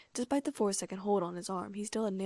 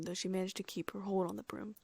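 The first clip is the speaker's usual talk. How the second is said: usual speaking voice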